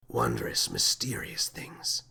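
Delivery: low, slow, breathless awe. A narrator's reading. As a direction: breathe it out, mesmerized. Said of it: "said the captain breathlessly".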